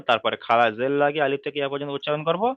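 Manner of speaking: ordinary talk, nothing marked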